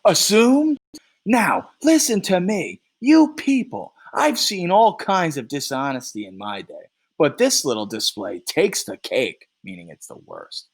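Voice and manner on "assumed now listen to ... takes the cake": loud, higher, furious outburst at the room; infuriated. Acted. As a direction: blow up at the whole jury room. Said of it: "Three's infuriated".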